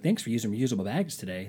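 normal talking voice

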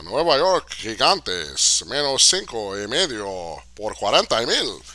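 hammed-up Spanish broadcaster calling in a bet; Telemundo Spanish voice. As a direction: boom it out like a Telemundo host. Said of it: "that's my Telemundo Spanish voice".

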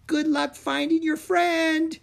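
high, slow sing-song farewell; fairy calling out. A narrator's reading. Fairy calling goodbye to Drake, high and slow. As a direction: call it after him like a fairy. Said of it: "Kiora called out".

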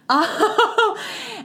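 sudden squeaky laugh; high burst of laughter. Real, not acted. Laughing at herself for nearly crying, high laugh. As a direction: laugh it off through tears.

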